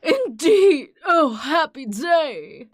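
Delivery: loud, slow overjoyed cry; delighted exclaim. Acted. Reading the inspector's line, loud and overjoyed. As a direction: proclaim it with glee.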